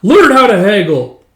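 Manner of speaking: high, loud wailing tirade; screaming and wailing. Retold or imitated. Railing at a clueless father, screaming and wailing. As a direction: hurl the insults in a high wail. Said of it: "He's just, like, screaming and wailing".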